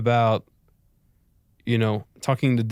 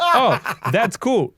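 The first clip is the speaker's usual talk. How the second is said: put-on French accent; 8 semitones higher, slower than usual. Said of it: "There's other things that were like".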